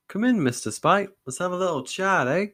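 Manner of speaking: a hint of Canadian accent, forced friendliness; mild Canadian accent, menacing politeness; vaguely Canadian. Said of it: "His accent was vaguely Canadian".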